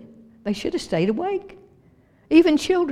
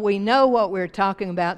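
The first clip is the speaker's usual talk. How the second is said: ordinary talk, nothing marked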